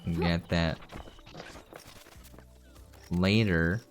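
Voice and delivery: normal talking voice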